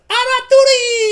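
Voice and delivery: very high, loud, drawn-out yell; goblin war cry. Acted. Marvin rallying the goblins, loud high drawn-out cry. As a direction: shriek it long in goblinese. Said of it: "And Marvin says".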